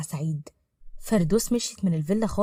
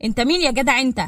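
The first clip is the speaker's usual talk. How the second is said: loud, raised-pitch irritated demand; angry challenge; 4 semitones higher, 6 dB louder than usual. Acted. Saeed opens the door to pounding, angrily challenging the caller. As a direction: confront the stranger at the door. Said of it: "سعيد بغضب بعد ما فتح".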